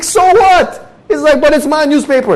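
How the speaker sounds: very high, loud back-and-forth; heated quarrel. Retold or imitated. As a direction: shout each other down. Quarrel with neighbor.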